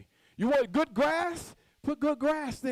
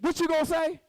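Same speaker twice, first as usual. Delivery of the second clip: higher, pressing the crowd for an answer; say it like you mean it; 6 semitones higher than usual. Shown as a demonstration. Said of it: "Y'all better say it like you mean it".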